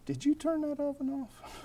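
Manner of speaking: distracting whisper. Preacher voices distracting evil angel.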